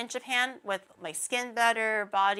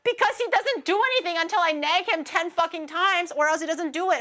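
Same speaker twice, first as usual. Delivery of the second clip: higher, louder complaint; exasperated rant; 8 semitones higher, 5 dB louder than usual. Real, not acted. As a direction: vent the frustration. Ranting about boyfriend's chores.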